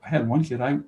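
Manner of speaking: ordinary delivery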